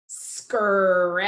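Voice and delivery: "Scrap" with each sound held, final p popped; slow sounding out. Shown as a demonstration. Teacher saying it with the class, slowly stretched. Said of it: "say it slowly".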